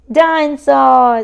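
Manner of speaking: loud joyful cry of the toy's name; delighted toddler. Acted. George welcoming his favorite toy back, loud glee.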